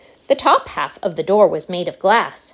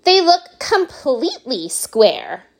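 put-on bratty girl; 6 semitones higher, slower than usual. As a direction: whine it like a spoiled brat. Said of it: "said Veruca Salt".

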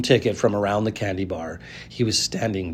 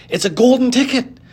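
much higher, loud excited shriek; screamed; 5 dB louder than usual. A narrator's reading. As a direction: scream it in pure amazement. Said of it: "screamed the shopkeeper".

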